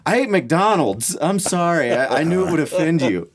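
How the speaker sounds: high-pitched, hesitant mock apology; playacted panicky excuse, pitched well up; mock guilty confession. Acted guilty confession to grandmother.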